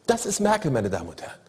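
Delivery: ordinary talk, nothing marked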